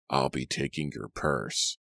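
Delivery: low, slow raspy thug voice; raspy whisper. A narrator's reading. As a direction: rasp it slowly, close to his ear. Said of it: "a raspy voice whispered".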